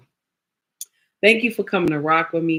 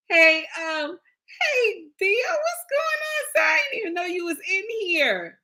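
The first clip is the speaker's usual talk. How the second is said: excited greeting; 12 semitones higher than usual. Real, not acted. Surprised greeting to arriving viewer.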